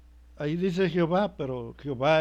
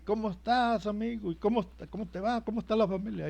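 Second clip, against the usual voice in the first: higher-pitched friendly phone greeting; acted-out phone small talk, raised pitch; chatty phone caller; 5 semitones higher, faster than usual. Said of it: "uno estaba hablando por teléfono".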